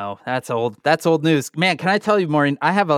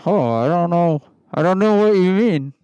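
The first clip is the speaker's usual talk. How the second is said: Rudy Giuliani voice; 2 semitones higher than usual. Pretend. Giuliani confused.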